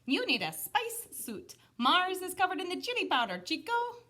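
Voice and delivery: loud, high, playful; high cheeky dog voice. Acted. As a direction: tease the kitty. Dog character explains.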